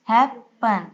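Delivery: "Happen" with a clear pause between हैप and पन; syllable division. Shown as a demonstration. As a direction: break "happen" at the double p. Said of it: "इनको divide करेंगे". ("Happen" को तोड़कर: हैप, पन)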